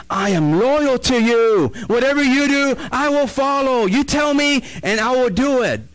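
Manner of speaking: loud, high declaration of loyalty; fervent pledge. Acted. Samuel pledging loyalty to King David, fervent pledge.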